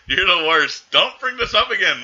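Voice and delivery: loud, high-pitched telling-off; exasperated scolding. Real, not acted. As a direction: scold your co-host for the silly joke, loudly. Scolds co-host for penis joke.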